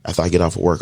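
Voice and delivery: usual speaking voice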